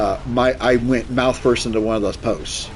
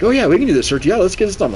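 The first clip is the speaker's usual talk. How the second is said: high, overeager mock doctor voice; eager doctor; 8 semitones higher, 4 dB louder, much faster than usual. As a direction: play the doctor way too keen.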